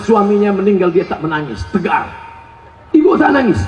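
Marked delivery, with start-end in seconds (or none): 2.9-3.6 questioning preacher. Acted. Preacher acts asking the widow.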